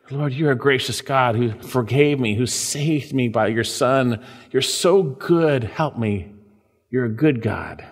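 low, hushed example prayer; modelled prayer. Shown as a demonstration. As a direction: pray it slowly and low, softening at the end.

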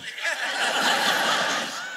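breathy little laugh at the punchline; preacher laughing at his own joke; soft laugh. Real, not acted. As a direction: chuckle quietly at the joke.

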